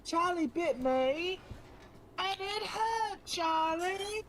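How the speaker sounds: put-on high, slow little British boy whining; Charlie Bit My Finger kid. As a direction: whine like the little boy in the video. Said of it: "Who the fuck doesn't know Charlie Bit My Finger".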